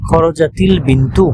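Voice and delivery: slow খরজাতিল with kasra on তা; joined with kasra. Shown as a demonstration. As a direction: stretch খরজাতিল so the linking ই is heard. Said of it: "তার মানে কাসরা".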